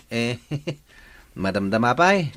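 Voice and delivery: usual speaking voice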